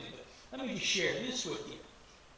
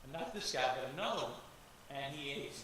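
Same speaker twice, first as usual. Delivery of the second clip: usual speaking voice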